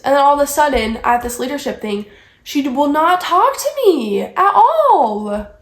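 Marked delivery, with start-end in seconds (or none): 2.4-5.5 slow, high-pitched indignant complaint; exasperated emphasis. Real, not acted. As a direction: stretch it out in disbelief. Venting about being ignored.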